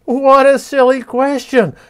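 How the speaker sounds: high, loud teasing call; fox calling back. A narrator's reading. Fox scampering away calling back to Basho, high and teasing. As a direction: toss the answer back, amused. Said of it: "the fox called back".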